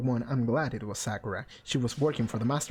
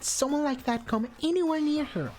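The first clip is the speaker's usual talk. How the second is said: put-on high, accusing young girl, rising to a yell; shrill teen girl accusing everyone; high Hina voice; 12 semitones higher than usual. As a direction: point the finger at the whole room, voice pitched way up. Hina forbidding.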